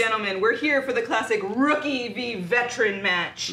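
loud wrestling announcer's opening; ring announcer. Pretend. Host opens the rookie-veteran match, ring announcer. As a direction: announce the match loudly to the crowd.

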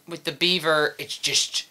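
low, soft voice through a shaped mouth; deep beaver voice. Shown as a demonstration. Puppeteer explains her beaver to the interviewer, deep voice. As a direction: sink into the deep beaver character. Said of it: "mouth shape and go deep".

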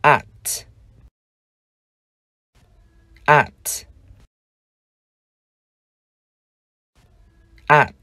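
at, one crisp syllable, a as in cat; English at. Shown as a demonstration. Teacher modeling at for learners. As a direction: say at with a short clear a. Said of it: "chúng ta đọc là".

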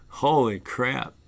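normal talking voice

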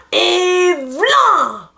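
high, forceful storytelling burst; big kick exclamation. A narrator's reading. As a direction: punch it like a mighty kick. Narrates a champion's big kick.

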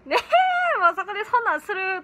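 high, loud flustered squeal at the screen. Retold or imitated. Fan flustered by a romantic drama scene, high loud squeal. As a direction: squeal as if a drama scene makes you blush. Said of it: "とか言ってしまいますね".

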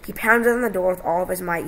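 ordinary delivery

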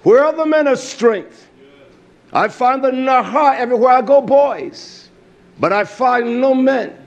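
preacher taunting the men in his pews, pitched high; fiery preaching. Acted. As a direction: call out the congregation from the pulpit. Preaching with rising force.

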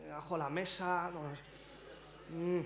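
soft, halting mock search through memory; trying to remember. Comedian demonstrating a wine taster recalling the lost remote, murmured pondering. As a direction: think it out under your breath, with hums. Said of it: "intentando recordar dónde habéis dejado el mando".

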